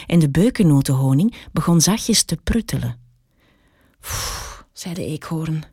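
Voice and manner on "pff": soft voiceless puff of breath from the heat; exhausted puff. A narrator's reading. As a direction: blow out air, wilting. Squirrel sweltering in the heat.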